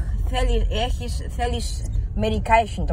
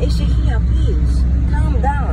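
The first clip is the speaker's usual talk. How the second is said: loud plea for calm; 3 semitones higher, 6 dB louder than usual. Real, not acted. Youth telling grandfather to calm down, raised voice. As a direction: call for calm, loudly.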